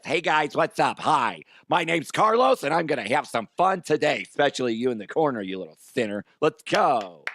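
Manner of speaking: hammed-up Carlos character voice; silly voice. Shown as a demonstration. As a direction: put on a goofy, lilting host greeting. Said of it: "is in silly voices".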